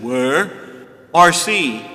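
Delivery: hammed-up Stone Age man demanding a drink; mock caveman call; Flintstone voice. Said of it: "That's the way he says it".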